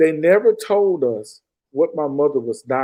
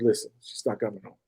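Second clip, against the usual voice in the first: quiet; 5 semitones lower, 8 dB quieter than usual. Retold or imitated. Son tells his father Mama won't come home, soft and low. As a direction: lower and much softer.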